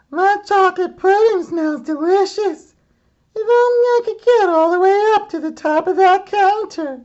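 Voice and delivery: put-on very high little girl voice; high, greedy little girl; Goldilocks. Goldilocks smelling pudding, very high little girl voice.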